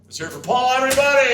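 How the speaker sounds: loud, high call for applause; rally the audience; crowd hype shout. Real, not acted.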